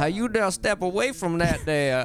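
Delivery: a hint of an old Southern man; lightly voiced old-time scolding; old-timey Southern accent. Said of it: "You sound like you were born in eighteen forty-six".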